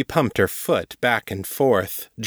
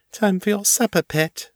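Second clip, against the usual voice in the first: high, playful and taunting; teasing mistress voice; 11 semitones higher than usual. A narrator's reading. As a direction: tease her pet with sweet menace. Ava commanding, higher.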